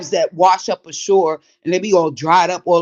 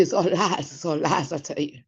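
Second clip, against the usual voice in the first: fading echo; 9 dB quieter than usual. Real, not acted. Insisting the pizza claims are lies, fading away softly. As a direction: repeat it softer and softer.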